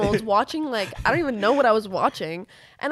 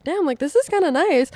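pleasantly surprised; 8 semitones higher, 7 dB louder than usual. Retold or imitated. Retold pleased reaction to fans.

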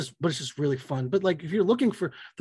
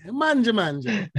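hammed-up Italian, slow and higher; mock Italian nonna; Italian accent; 6 semitones higher, slower than usual. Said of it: "I knew you were gonna do that too".

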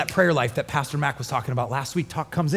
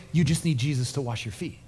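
ordinary talk, nothing marked

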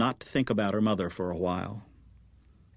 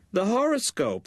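repeated much higher and firmer; high, emphatic repeat of the horoscope; insistent mother; 11 semitones higher than usual. Acted. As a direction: say it again, pitched way up to make her hear. Mother repeats after "What?.

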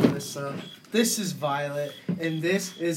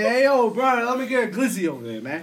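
loud, higher New York street call; mock New York talk; 7 semitones higher, 6 dB louder than usual. Host asking how New Yorkers order, mock New York holler. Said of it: "So how y'all say".

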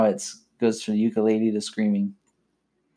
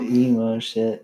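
mock emo wail; 13 semitones higher, much slower than usual. Mocking the emo song.

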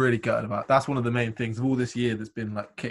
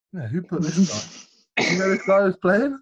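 confused parent; 7 semitones higher, 4 dB louder than usual. Retold or imitated. Imitating confused festival parents.

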